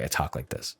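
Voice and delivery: low, soft business-meeting voice. Shown as a demonstration. Host shows girlfriend's named business voice from meetings, low and quiet. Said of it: "she calls my business voice".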